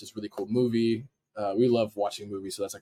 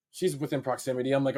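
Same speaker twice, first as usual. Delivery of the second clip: ordinary talk, nothing marked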